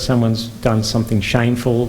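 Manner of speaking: ordinary delivery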